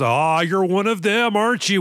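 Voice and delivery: mock a bad American accent; fake American accent. Villain bluffing the hero, fake American accent. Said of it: "in this fake American accent".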